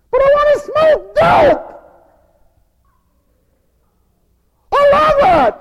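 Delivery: very high, loud mocking shout; defiant teen. Rebellious teen defying mother, very high shout.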